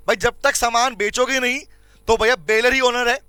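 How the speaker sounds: ordinary talk, nothing marked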